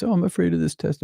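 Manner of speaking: hammed-up anxious whine; mock worried voice; whiny fearful quote. Mimicking own anxious thought.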